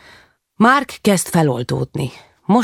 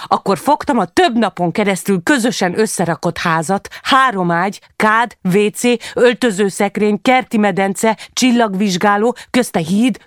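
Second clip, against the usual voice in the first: agitated narration, steadily higher to the climax; building outburst; 5 semitones higher than usual. A narrator's reading. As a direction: pitch climbing through a mounting list.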